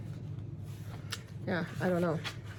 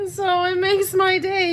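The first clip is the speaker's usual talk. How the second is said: emotional laughing squeal; 10 semitones higher, 5 dB louder than usual. Real, not acted. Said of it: "I am not saying this out of, you know, sadness".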